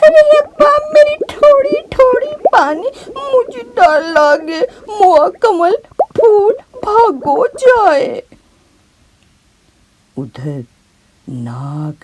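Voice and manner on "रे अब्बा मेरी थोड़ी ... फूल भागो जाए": put-on much higher, louder terrified child's shriek; girl shrieking in terror to her father, crying; screaming. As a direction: scream for your father in panic. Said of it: "जोर से चीख कर कहा".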